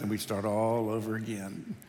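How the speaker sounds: ordinary delivery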